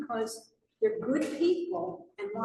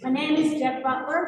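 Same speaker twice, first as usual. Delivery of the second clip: louder, projected introduction; raised voice, repeating on request; speaking up; 3 semitones higher, 7 dB louder than usual. Real, not acted. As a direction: speak up so the room hears. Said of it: "Speak up, please".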